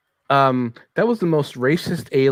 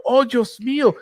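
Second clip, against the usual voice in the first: mock Mexican accent; 12 semitones higher than usual. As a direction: wail it lightly in a hammed-up Mexican accent. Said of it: "you know, Mexican, uh, you know, uh, going like".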